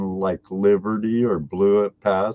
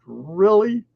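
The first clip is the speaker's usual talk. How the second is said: high, disbelieving double "really"; incredulous; 11 semitones higher than usual. Retold or imitated. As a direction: act shocked disbelief at the border guard. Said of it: "And I'm like".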